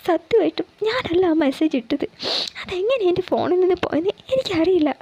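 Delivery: high, tearful begging; tearful pleading. Acted. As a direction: beg him to believe you, close to tears. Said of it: "അവൾ പൊട്ടിക്കരഞ്ഞുകൊണ്ട് പറഞ്ഞു". (കരഞ്ഞുകൊണ്ടുള്ള അപേക്ഷ)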